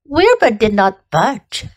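plain reading voice

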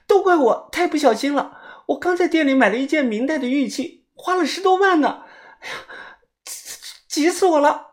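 very high, fretful woman's wail; frantic self-reproach. A narrator's reading. Woman blames herself for losing costly jade, high frantic fretting. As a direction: fret aloud in a high acted voice. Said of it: "白霜着急的一跺脚".

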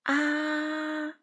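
long, steady, high "ah"; demonstrated "ah". A narrator's reading. Rabbit has Frog repeat after him, demonstrated "ah". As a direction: hold an even "ah" as a voice test. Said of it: "请跟着我说".

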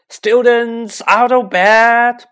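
very loud, high, drawn-out alarm; full-voiced tattling yell; bellowing. A narrator's reading. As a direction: bellow it slowly down the corridor. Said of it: "Peeves bellowed".

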